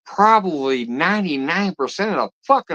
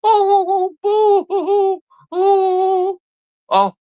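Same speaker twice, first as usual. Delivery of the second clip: mocking crybaby; slower than usual. Mocks viewer's threat to leave.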